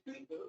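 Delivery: "Twitter" softly, as a third mangled variant; babbled English. Retold or imitated. Lecturer mimicking a third announcer, "Twitter" in babbled English. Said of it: "veo esos locutores balbuceando".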